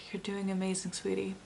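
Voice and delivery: very soft, hushed reassurance; tender encouragement. Retold or imitated. Reader passes on a grandmother's message to somebody, tender and hushed. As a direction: breathe out, then say it very quietly, like a loving grandmother.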